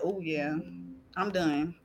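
low, soft self-assurance; retold relief. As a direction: say it low, settled and sure. Retold relief after getting up.

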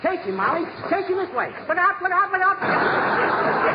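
high, frantic calling. Acted. Husband directing his wife in the owl chase, frantic. Chasing owl through the room.

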